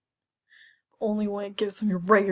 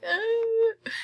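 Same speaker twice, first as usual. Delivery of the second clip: squeak; 12 semitones higher than usual. Retold or imitated. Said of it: "I'm like".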